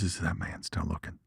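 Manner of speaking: soft, low sly whisper-like voice; sneaky snake voice. Retold or imitated. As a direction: play the snake peeking to see if its trick worked.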